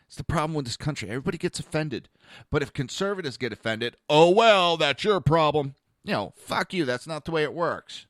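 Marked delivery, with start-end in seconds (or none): 4.1-5.8 loud sneering mimicry; dismissive mockery. Mocking liberals brushing off conservatives, loud sneer. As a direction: toss it off with contempt.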